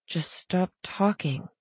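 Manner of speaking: soft, slow inward pleading; hushed, slowed unspoken thought; silent plea. A narrator's reading. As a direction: plead under your breath, drawn out. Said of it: "she pleaded silently".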